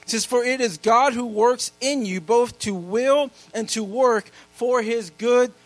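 loud, high preacher's reading of scripture; preaching scripture. Acted. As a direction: proclaim every verse to the whole church. Reads scripture at full preaching force.

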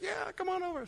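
high, sing-song imitation of the friendly women; mock middle-aged lady. Women at the bar inviting the couple over, high mock lady voice. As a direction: pipe up high like the welcoming ladies. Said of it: "they're like".

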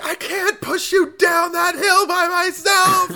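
very high, drawn-out mock scream; Sally screaming. Imitating her wrestling him for the flashlight, high screamed complaint. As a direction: shout it slowly, way up high. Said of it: "she's just screaming".

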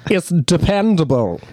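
high, slow mock Southern accent; hammed-up accent. Co-host imitating the executioner, drawn-out Southern accent. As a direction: stretch it out in an exaggerated Southern twang. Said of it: "He's got an accent for sure".

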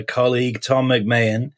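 normal talking voice

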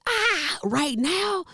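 a put-out "right now?"; exasperated. Retold or imitated. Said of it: "I'm like".

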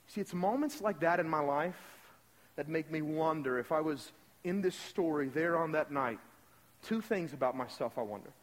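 normal talking voice

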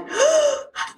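high sharp gasp of shock. Retold or imitated. Mother hearing her son stole supplies, shocked gasp. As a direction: suck in air in disbelief.

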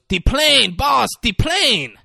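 high, slow put-on Tattoo voice, squeaky and drawn out; Tattoo impression. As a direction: squeak out the plane call like Tattoo. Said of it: "he goes".

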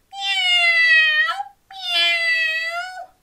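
very high, stretched meows; cat meow. Acted. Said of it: "join in with all of these".

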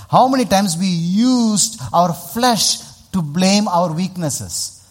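high, loud, drawn-out words; emphatic preaching. Real, not acted. Preacher challenging the crowd on blaming flesh, loud and emphatic.

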